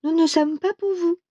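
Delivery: high-pitched voice of the maidens; young maidens' voice. A narrator's reading. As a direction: lift the voice high, as maidens replying. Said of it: "Les vierges ont dit". (voix aiguë de jeunes vierges)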